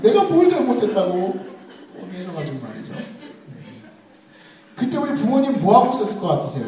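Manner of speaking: ordinary delivery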